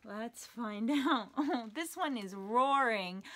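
plain reading voice

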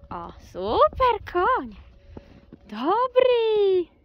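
high, loud, drawn-out praise to the horse. Real, not acted. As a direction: call out praise across the arena. (głośna pochwała dla konia)

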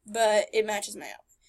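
normal talking voice

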